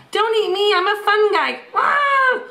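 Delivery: beg, then squeal; pleading mushroom. Acted.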